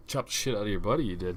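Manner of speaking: low-key, offhand British turn of phrase; British accent. Real, not acted. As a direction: slip casually into a British accent. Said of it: "went all British on you".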